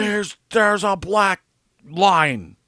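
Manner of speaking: slow, high, loud mock holler; imitated yelling. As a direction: stretch it out like a guard yelling from afar. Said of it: "You will get yelled at".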